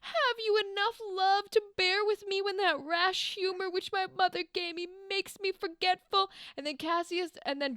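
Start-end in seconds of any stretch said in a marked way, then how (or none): 0.0-6.3 high, theatrical plea; hammed-up Shakespearean pleading; pleading lover. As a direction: plead in a raised, lovesick voice. Acting Cassius pleading with Brutus.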